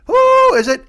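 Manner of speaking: high, loud squawk of a parrot. Retold or imitated. The parrot taunts the plumber at the door, high squawk. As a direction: screech it like a cartoon parrot. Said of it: "a parrot that's taunting you".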